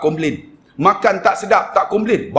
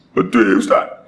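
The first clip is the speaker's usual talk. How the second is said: low, put-on weepy voice; mock tearful; 6 semitones lower, slower than usual. Single mother tearfully agreeing with the preacher, mock crying. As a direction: answer as if crying, played for laughs. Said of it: "ibu tunggal ni teriak".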